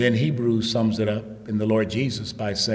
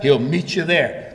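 usual speaking voice

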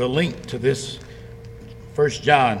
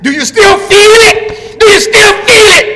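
taunting shout; 14 dB louder than usual. Retold or imitated. Preacher taunting the man at the chapel with a jeering shout. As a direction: hurl it back at him mockingly.

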